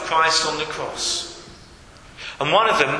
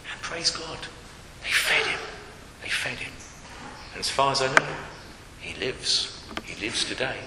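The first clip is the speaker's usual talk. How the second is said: soft, low, reverent relief; hushed wonder; 6 semitones lower, 9 dB quieter than usual. Real, not acted. Preacher telling congregation the bird survived, hushed wonder. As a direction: let it sink low and soft, with pauses.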